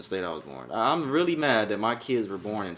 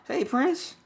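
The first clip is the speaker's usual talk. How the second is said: much higher sing-song greeting. Real, not acted. Greeting son Prince on call.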